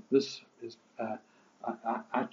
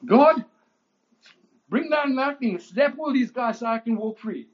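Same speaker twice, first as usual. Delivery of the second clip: high, loud, hammed-up cry for lightning; mock vengeful prayer; 12 semitones higher, 7 dB louder than usual. Preacher mocking the prayer Polycarp didn't pray, hammed-up. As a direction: ham up a prayer he never prayed. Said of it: "No, that's not what he said".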